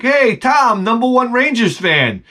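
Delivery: high, bright teasing call; sing-song tease of a fan; mock cheerful greeting. Teasing a coworker about his team, high mock cheer.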